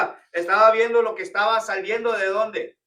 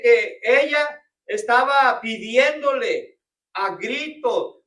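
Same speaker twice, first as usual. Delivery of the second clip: louder, building preacher's cry; preaching; 2 semitones higher, 7 dB louder than usual. Acted. Pastor urging the congregation, preaching with force. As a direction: press each phrase home to the congregation.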